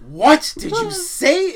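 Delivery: shocked teacher. Retold or imitated. Teacher shocked at the student's word, slow and high. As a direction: play the stunned French teacher, drawn out. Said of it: "this is exactly what the French teacher's reaction was".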